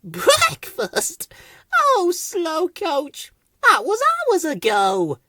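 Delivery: very high, drawn-out little voice; high, slow, amused squeak; giggly Weed. A narrator's reading. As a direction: tease in a high sing-song. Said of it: "Weed looked up and giggled".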